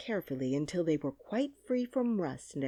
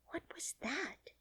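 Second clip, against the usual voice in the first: hushed, frightened whisper; startled little girl's whisper; timid whisper; 10 semitones higher, 10 dB quieter than usual. Acted. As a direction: whisper it, scared of the sound. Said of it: "she asked timidly".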